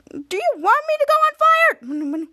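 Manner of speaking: slow, high outraged question; indignant disbelief; incredulous. Retold or imitated. Piper incredulous in retold scene.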